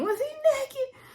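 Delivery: high, laughing question; laughing shout. Retold or imitated. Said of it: "I was in there shouting".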